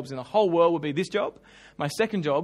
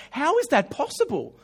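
higher, incredulous question; amazed; 7 semitones higher than usual. Retold or imitated. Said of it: "I'm going".

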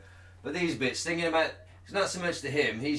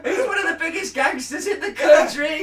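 laughing mock shout; 12 semitones higher, 9 dB louder than usual. Acting outraged resident.

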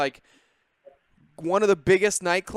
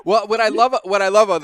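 talking through laughter, louder and higher; laughing; 5 semitones higher, 8 dB louder than usual. Real, not acted. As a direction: laugh out the words. Host laughing while speaking.

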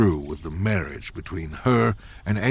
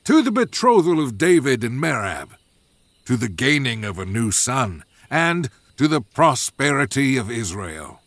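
ringing toast, pitched up at the start; joyful royal toast; 4 semitones higher than usual. Acted. King Saul toasts the betrothal before his family, joyful. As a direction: raise the cup and proclaim it like a king. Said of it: "Saul joyfully raised his cup".

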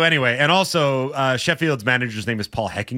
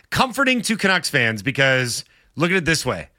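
ordinary talk

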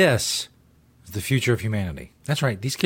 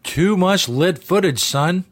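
slow, higher mocking repeat of the line; mocking echo; 6 semitones higher, 3 dB louder, slower than usual. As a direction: throw his words back at him, slowed. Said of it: "host mocks vlogger's line".

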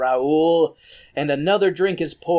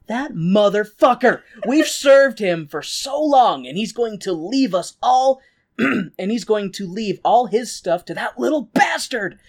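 hammed-up villain rant, high and loud; mock furious rant; 5 semitones higher, 3 dB louder than usual. Villainess Trunchbull raging at the Phantom's son, hammed-up fury. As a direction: spit it out like a scheming villainess. Trunchbull rants about the heir.

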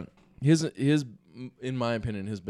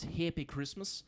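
put-on Australian-like accent; 3 semitones higher, 11 dB quieter, slower than usual. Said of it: "exactly how an Australian would say it".

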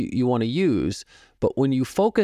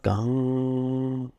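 a held, humming imitation of a struck gong; sung gong tone; 3 dB quieter than usual. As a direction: hum out the ring of a gong and let it hang. Imitating a gong note in lesson.

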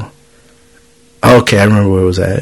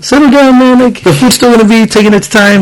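retold kitchen yell; 10 semitones higher than usual. Cook yelling in the kitchen about food taking its time, mock yell. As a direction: act out yelling at a coworker, voice pitched way up. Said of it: "in the kitchens, people always yell".

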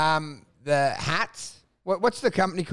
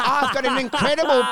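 higher, louder, laughing boast; mock boast; 5 semitones higher, 4 dB louder than usual. Bragging about the broker, mock boast. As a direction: brag like a smug client. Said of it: "you're like".

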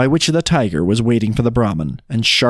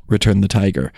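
plain reading voice

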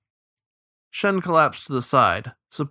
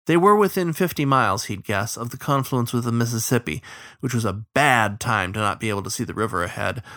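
plain reading voice

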